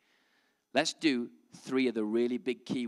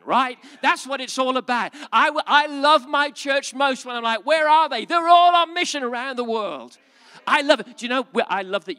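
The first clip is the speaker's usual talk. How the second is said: high, loud, excited pulpit cry; fervent preaching; 9 semitones higher, 10 dB louder than usual. Acted. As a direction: whip the congregation up with joy. Preaching with rising fervor.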